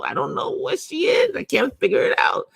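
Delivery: high-pitched, acted-out bafflement; exasperated bafflement. Client venting to her therapist about a puzzling coach, mock exasperated. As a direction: throw up your voice in mock confusion.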